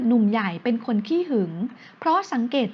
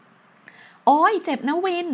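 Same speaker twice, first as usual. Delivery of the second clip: getting angry; 6 semitones higher, much slower than usual. A narrator's reading. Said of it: "น้ำเสียงเธอเริ่มโกรธ".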